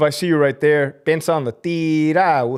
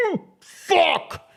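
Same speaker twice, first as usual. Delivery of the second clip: laughing curse. Real, not acted. Laughing at the scandal.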